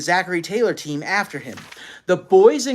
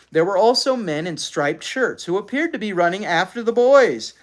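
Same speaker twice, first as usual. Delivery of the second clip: usual speaking voice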